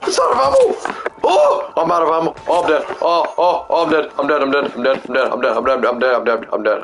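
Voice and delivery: high-pitched panicked yelling, easing as it repeats; frantic gamer facing sudden defeat; real panic. Real, not acted. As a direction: burst out high, then rattle on in panic. Out of ammo, dying in game.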